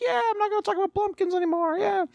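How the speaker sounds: very high, fast mock child voice; imitated excited kid. Distracted kid agrees with parent, high excited mock voice. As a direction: squeal it quickly like a thrilled kid.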